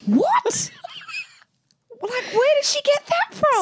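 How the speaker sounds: high-pitched squeal of astonishment; shocked disbelief. Real, not acted. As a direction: react in real amazement, voice leaping way up. Stunned reaction to child's swearing.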